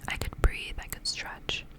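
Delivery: ordinary delivery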